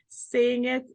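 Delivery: ordinary talk, nothing marked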